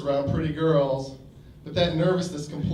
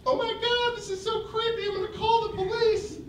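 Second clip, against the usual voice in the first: very high, softer, mock frightened falsetto; woman's voice; 3 dB quieter than usual. Woman threatens to call police, high scared falsetto. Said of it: "She'd say".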